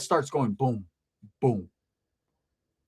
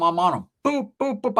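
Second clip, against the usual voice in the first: ordinary talk, nothing marked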